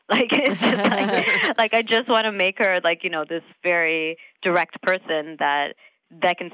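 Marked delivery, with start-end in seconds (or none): 0.1-1.6 laughing. Real, not acted. Laughing at her own joke.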